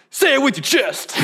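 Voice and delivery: high, forceful comic delivery; from the chest. Shown as a demonstration. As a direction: belt it out like a stand-up comic. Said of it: "That's how I wanna say it".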